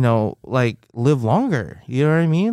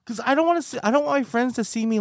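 higher, giggly confession; high laughing voice; 10 semitones higher, faster than usual. Real, not acted.

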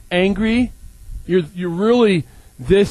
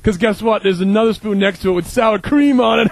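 louder, punchy reveal of the punchline; loud comic reveal; 7 semitones higher, 5 dB louder than usual. Real, not acted. Telling the crowd about his gross spoon, loud reveal. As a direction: announce it like a gleeful confession.

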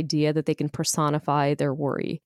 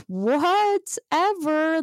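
high, drawn-out dismissive whatever; 10 semitones higher, much slower than usual. Acted. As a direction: brush the worry off slowly. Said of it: "I'm like".

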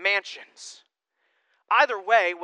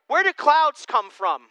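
mimicking a curious child; 9 semitones higher, 8 dB louder, slower than usual.

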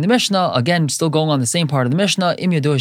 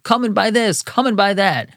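mock market hawker call, pitched higher; vendor hawking; 6 semitones higher than usual. Market vendor calling to shoppers, lightly hawking. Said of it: "yelling out".

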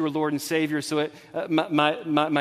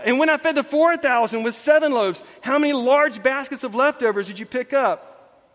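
louder, higher, insistent quizzing; exasperated Jesus; 5 semitones higher, 4 dB louder than usual. Retold or imitated. Jesus quizzing disciples on seven loaves, acted exasperation. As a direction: press the question in acted exasperation. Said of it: "wanting to pull his hair out".

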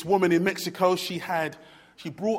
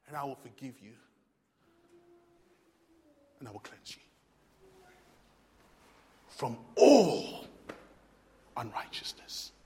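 much softer, fading to a whisper; hushed promise; 6 semitones higher, 7 dB quieter than usual. Acted. Preacher voicing Jesus's promise of forgiveness, softly to a whisper. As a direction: drop almost to a whisper.

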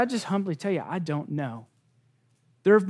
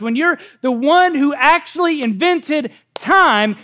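louder, higher preaching, driving each phrase home; full-force preaching; 6 semitones higher, 10 dB louder than usual. Acted. Pastor preaching to the church, loud and high.

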